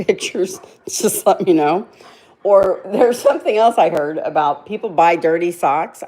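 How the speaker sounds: usual speaking voice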